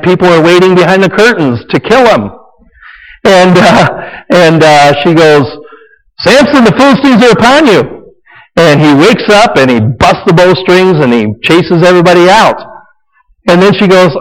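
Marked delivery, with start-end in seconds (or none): 6.2-8.0 high, mock-urgent cry of warning; mock Delilah alarm. Delilah warns sleeping Samson, high mock alarm.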